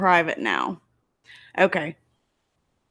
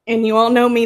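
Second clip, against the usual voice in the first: normal talking voice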